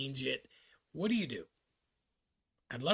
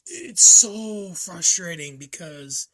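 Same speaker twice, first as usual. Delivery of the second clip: frustrated outburst; 4 semitones higher, 12 dB louder, slower than usual. Real, not acted. Venting about stressed dogs.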